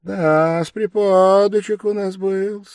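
high, loud, fussy cluck; clucking sympathy. A narrator's reading. Investigator scolding his sick guest, clucking sympathetically. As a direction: cluck over him with friendly concern. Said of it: "закудахтал с дружественным участием".

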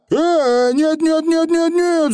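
very high repeated squeals; Feegle's frantic denial. A narrator's reading. Feegle denying a break-in, frantic high cry. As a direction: yelp out a string of no's. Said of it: "закричал Явр заядло".

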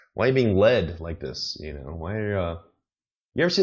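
ordinary talk

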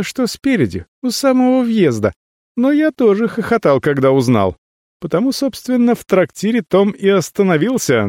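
put-on high, cocky young mage. A narrator's reading. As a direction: talk like a smug young mage. Getejnir's character voice.

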